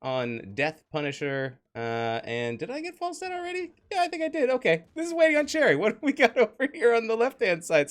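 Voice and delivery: high, loud play-by-play; hyped caster. Acted. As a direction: hype up the lineup. High energy casting.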